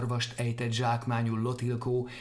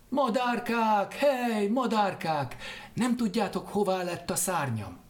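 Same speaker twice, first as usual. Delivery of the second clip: calling to the birds; 8 semitones higher than usual. Acted. Lotilkó calls to the birds. (magas, elnyújtott kiáltás a madarakhoz)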